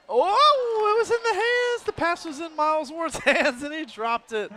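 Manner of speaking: sudden high cry of disbelief; excited. Real, not acted. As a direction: voice jumps up at the drop. Dropped pass reaction.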